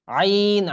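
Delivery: Arabic ain demo; throaty ain. Shown as a demonstration. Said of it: "এই যে এখান থেকে আসবে".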